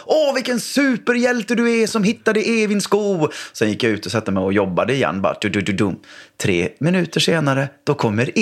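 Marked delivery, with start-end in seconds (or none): 0.1-3.3 high, admiring praise; shower him with praise; praising dad. Retold or imitated.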